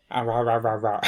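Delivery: rhythmic puppet mouth noises; puppet jabber. Pretend. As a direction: flap a cardboard mouth, loud. Cardboard cutout mouth puppet bit.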